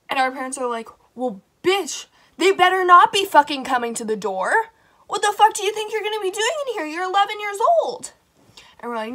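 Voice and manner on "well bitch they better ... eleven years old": imitating the mom, higher and louder, fast; mock angry mom. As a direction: play the outraged mother snapping at her kids. Moms scolding, voiced in story.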